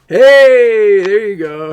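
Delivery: loud high greeting shout; thrilled shout when the silent caller finally speaks; excited shout. Real, not acted. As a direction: burst out hello as the caller connects.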